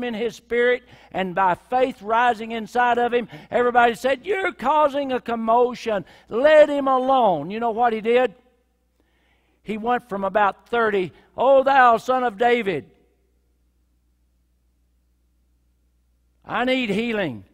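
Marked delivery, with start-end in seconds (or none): 4.3-7.5 scolding. Acted. Crowd scolding the blind man. 11.4-17.4 loud pleading cry for healing. Acted. Blind man crying out again over the hushing crowd, loud.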